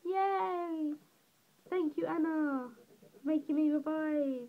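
high, celebratory cheer; gleeful thanks. Real, not acted. Celebrates perfect German test, thanks helper.

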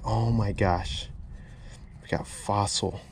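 ordinary talk

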